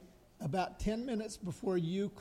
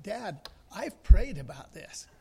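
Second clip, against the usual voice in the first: pleading daughter; 10 dB louder, slower than usual. Retold or imitated. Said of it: "she finally said".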